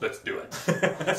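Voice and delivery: very slow, laughing tough-guy line; mock tough Abraham. Host pretends Abraham says it, very slow and laughing. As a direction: drawl it out like an action hero.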